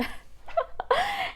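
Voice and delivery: a quick amused laugh; short high laugh. Real, not acted. Daughter laughing about mom missing her old wallet, short fond laugh. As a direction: let a fond laugh slip out.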